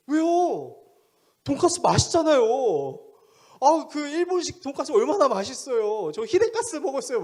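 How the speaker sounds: high, loud, whiny comeback; indignant protest. Retold or imitated. Young man defending his pork cutlet to pastor, indignant. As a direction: argue back as the clueless young man.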